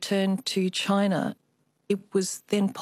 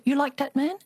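put-on higher Chinese-accented grandmother; 7 semitones higher than usual. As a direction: imitate a Chinese mother, lighter. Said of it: "I always have to do the Chinese accent".

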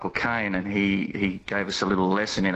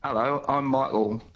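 higher-pitched imitation of the actor's accent; Michael Caine; 5 semitones higher than usual. Guest obliges radio host with a Michael Caine accent. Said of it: "you have to do the accent".

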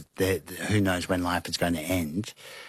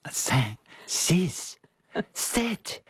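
mock French PR guy; 10 semitones higher, much slower than usual. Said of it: "the French P R guy was going".

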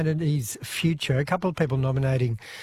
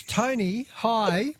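normal talking voice

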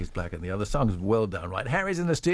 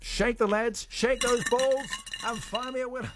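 game-show command; 7 semitones higher than usual. Real, not acted. Host commands the prize draw.

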